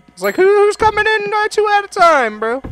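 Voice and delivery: high squeaky disbelief; squeaky surprise. Acted. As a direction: pitch up in disbelief.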